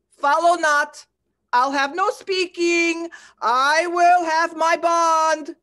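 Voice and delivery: high and forceful, drawing out I will have my bond; angry outburst. Acted. Shylock shouting down Antonio's plea, high and furious. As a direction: let the fury break loose on will. Shylock refusing to hear pleas.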